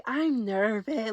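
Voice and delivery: soft, slow, nervous murmur; timid I'm nervous. Retold or imitated. ET answering Rolly, a soft slow nervous murmur. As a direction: barely get it out, slow and small.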